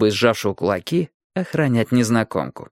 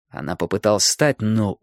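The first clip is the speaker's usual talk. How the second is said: usual speaking voice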